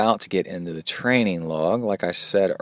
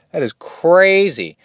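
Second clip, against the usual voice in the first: amazed; 8 semitones higher, 4 dB louder, slower than usual. Real, not acted. Amazed at a gut statistic.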